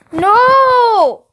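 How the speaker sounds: long, high, loud "no"; panicked scream. A narrator's reading. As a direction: scream to stop the photo. Panicked protest at the photo.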